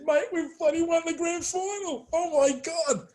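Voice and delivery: high, excited blurt of disbelief; blurting to a teammate mid-game, giddy and high; elated outburst. Retold or imitated. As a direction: celebrate like you've just won it all. Player celebrating mid grand final.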